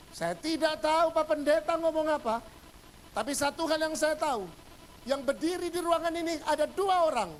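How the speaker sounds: high, loud mock-earnest declaration; acted shout. Confused man standing up to answer the pastor, shouting. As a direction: shout it out across the room like a bewildered churchgoer. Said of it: "Dia teriak gitu".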